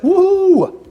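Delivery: high, loud "woo"; playful whoop. Real, not acted. Host switching over who asks the questions, playful whoop. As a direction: let out a big playful whoop.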